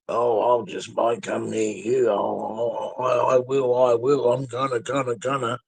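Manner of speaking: stammering mock of a boaster, lightly voiced; sneering imitation of Frank, tripping over gonna. Mocking Frank's empty threat with a stutter. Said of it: "It's funny when he says that bullshit".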